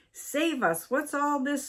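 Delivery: high panicky cry, a mild mock scream; frightened scream. A narrator's reading. Wife dodging fireworks, high frightened mock scream. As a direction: squeal in alarm at the fireworks. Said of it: "screamed poor Missus Twiddle".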